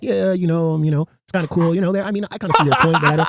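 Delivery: half-hearted mimic of a too-nice yes-man; mock polite company guy. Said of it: "The guy now is just like".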